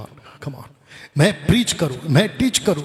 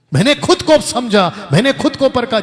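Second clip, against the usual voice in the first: fervent testimony; 7 semitones higher, 5 dB louder than usual. Real, not acted. Preacher proclaims how he tested himself. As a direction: proclaim it with conviction.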